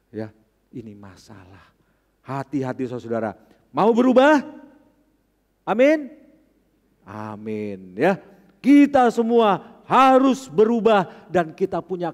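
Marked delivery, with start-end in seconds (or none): 3.7-6.1 loud, high call for amen; rally the crowd; calling out to congregation. Real, not acted. 8.6-12.1 loud, high preaching with slowed emphasis; fiery preaching. Acted.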